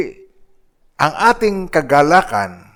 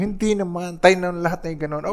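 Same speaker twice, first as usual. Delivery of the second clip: siblings defending; 5 semitones higher, 5 dB quieter than usual. Retold or imitated. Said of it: "idepensa ng mga kapatid".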